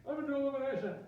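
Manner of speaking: high, whiny mock complaint; griping student. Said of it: "imagine somebody griping for a while".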